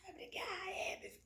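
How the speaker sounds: whispered mock egging-on; high, very soft breath of mischief; gleeful onlooker. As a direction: imitate a grinning bystander under the breath. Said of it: "ficar olhando com um sorriso e falar".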